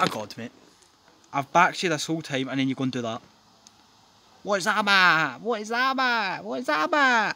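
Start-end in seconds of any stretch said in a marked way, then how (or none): 4.4-7.4 each repeat higher, climbing to an outraged cry; building exasperation. Real, not acted. Fan berating his player, growing more outraged with each repeat. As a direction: demand an answer, rising each time.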